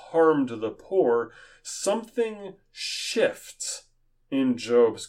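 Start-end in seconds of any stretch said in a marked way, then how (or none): 1.6-3.9 drawn out. Real, not acted.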